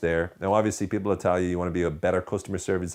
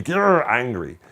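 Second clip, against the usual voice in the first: drawn-out growl of mock anger, louder; mock angry; 6 semitones higher, 7 dB louder, much slower than usual. Shown as a demonstration. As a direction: snarl it slowly, hammed-up anger. Demonstrating getting angry over small things.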